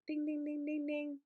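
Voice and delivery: high sing-song ding like a prize bell; playful bell chime; mock reward bell. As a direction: chime it like a game show win.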